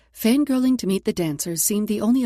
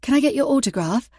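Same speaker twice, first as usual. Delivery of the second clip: put-on eager British accent; 4 semitones higher, 5 dB louder than usual. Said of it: "using her best British accent".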